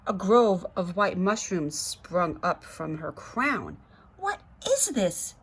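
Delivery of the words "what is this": high, piercing shriek of dismay; screeching girl. A narrator's reading. Girl screeching at her mushroom head. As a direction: shriek it at the mirror. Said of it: "she screeched".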